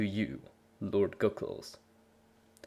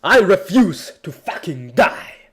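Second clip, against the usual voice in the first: high, loud defiant yell; screamed defiance; 8 semitones higher, 5 dB louder than usual. Acted. Hero refusing death in his story, screaming. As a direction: roar your refusal to die. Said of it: "I screamed into the frozen night".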